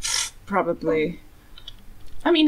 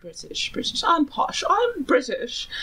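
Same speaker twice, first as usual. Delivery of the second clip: warm up a posh British accent; self-mocking attempt at posh British; bad posh British accent; 8 semitones higher than usual. Shown as a demonstration. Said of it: "worst British accent I've ever done".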